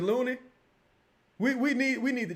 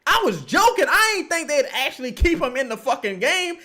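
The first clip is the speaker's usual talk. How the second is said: normal talking voice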